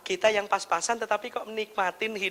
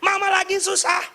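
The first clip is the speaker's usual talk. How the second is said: high-pitched mock scolding mum; angry mother; 9 semitones higher than usual. Mother snaps at child about being hard up, angry scolding. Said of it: "mamanya marah-marah bilang".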